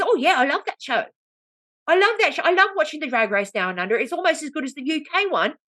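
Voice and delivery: high, gushing mock English woman; British accent. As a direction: gush in a put-on British voice. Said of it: "not allowed to do British accent".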